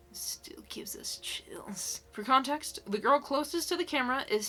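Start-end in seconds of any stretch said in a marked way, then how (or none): 0.1-2.0 slow eerie whisper. Pretend. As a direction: breathe out the shiver. Spooky end of story.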